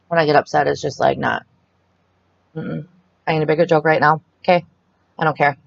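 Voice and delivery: usual speaking voice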